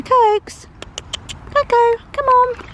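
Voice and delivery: high, loud call to the horse. Real, not acted. Calling her horse Coco over to her, high and loud. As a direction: shout her over, come on.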